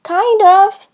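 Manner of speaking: a loud, high call up from below; loud high reply from below; yelled. A narrator's reading. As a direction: shout it up out of the cave. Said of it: "Sally yelled".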